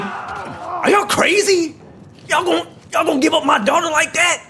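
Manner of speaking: loud and high, furious disbelief; outraged shout. Real, not acted. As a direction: rage at them for the daughter. Gamer raging at enemies.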